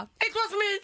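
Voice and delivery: high, frantic blurt; a guilty tourist confessing in a high rush; panicked confession. Retold or imitated. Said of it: "jag bara".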